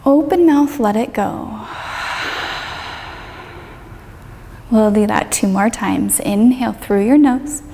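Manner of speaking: calm yoga teacher leading a meditation; guided meditation. Acted. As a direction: ease the listener down through the body, unhurried. Leads a guided body-scan meditation.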